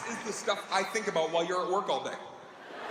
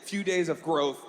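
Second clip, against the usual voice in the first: ordinary talk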